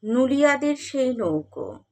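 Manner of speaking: measured, expressive verse reading with long pauses; dramatic recital with rising and falling cadences; poetry recitation. Acted. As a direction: recite the poem phrase by phrase, letting line endings sink. Recites a poem.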